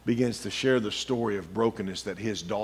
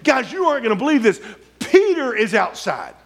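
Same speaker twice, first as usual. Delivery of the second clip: laughing excitedly; 10 semitones higher, 5 dB louder than usual. Acted. Rhoda's excited news.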